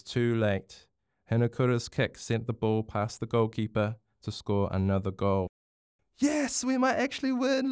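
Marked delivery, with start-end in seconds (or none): 6.2-7.7 high, thrilled cheer; excited exclamation. A narrator's reading. Louis sees his team might win, exclaiming. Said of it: "Louis exclaimed".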